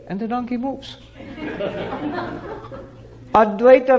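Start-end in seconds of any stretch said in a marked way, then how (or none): 1.2-2.8 a quiet breathy chuckle; soft laugh. Real, not acted. Storyteller laughing as the donkey finally moves. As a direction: chuckle softly at the punchline.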